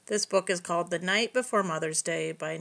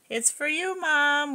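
put-on excited kids; 7 semitones higher, slower than usual. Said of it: "we cried".

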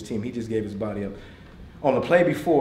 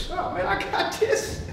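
high, drawn-out mock bravado; mock overconfidence; slower than usual. Adult bluffing to a group, mock cocky. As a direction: brush it off with a laughing, high-pitched swagger. Mimics adults putting on a face.

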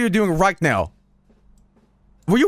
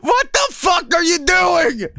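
shouting at the screen; 8 semitones higher than usual. Real, not acted. Yelling at the screen.

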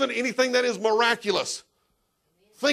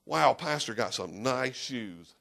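much lower, softer, slow "Wow"; mock admiring listener; 9 semitones lower, 8 dB quieter, slower than usual. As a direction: gawk at the pastor's shoes. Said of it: "you could say".

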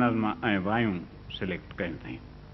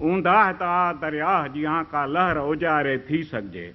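ghazal recitation; 4 semitones higher than usual. Acted. Poet opening his ghazal for the audience, recited. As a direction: recite each line as poetry, letting it fall at the close.

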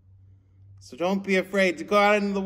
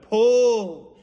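loud, higher shout of Pull!; shouted order; 6 semitones higher, 7 dB louder than usual. Acted. Peter shouting to the fishermen to pull, loud. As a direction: bark the command to haul the nets. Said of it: "Peter shouted".

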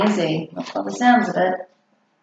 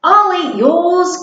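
higher, louder, slow eager offer; eager cry; 9 semitones higher, 4 dB louder, slower than usual. A narrator's reading. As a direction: jump in eagerly to grab the food. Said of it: "cried Monty's big sister Monica".

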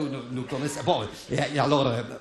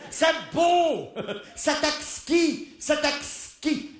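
high, very slow swooning praise; drawn-out mock rapture; hammed-up delighted woman; ecstatic lady; 9 semitones higher, much slower than usual. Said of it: "elle le disait très fort".

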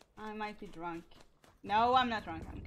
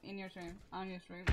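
ordinary talk

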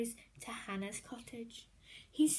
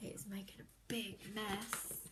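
softer, hushed aside; whispered aside; 5 semitones lower, 10 dB quieter than usual. A narrator's reading. Narrator tells children about the mess, whispering.

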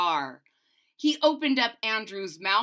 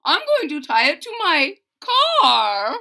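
louder, gleeful dentist; dentist's voice; 7 semitones higher, 9 dB louder than usual. A narrator's reading. Dentist tells the boy he'll tie the rope to his car. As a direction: announce the plan with relish.

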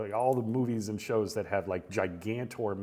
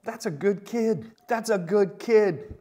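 higher and louder, dragging it out; excited praise; 10 semitones higher, 6 dB louder, slower than usual. Real, not acted.

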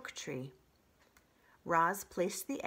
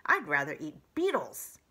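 louder, gruff badger voice; grumpy badger; 2 semitones lower, 7 dB louder than usual. Badger leaving in disgust, louder. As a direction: grumble like a sulky badger.

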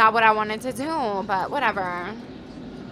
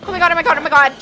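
loud, higher, flustered urging; panicked self-talk; 6 semitones higher, 6 dB louder, faster than usual. Real, not acted. Gamer telling herself to concentrate, panicked. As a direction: urge yourself on in a panic.

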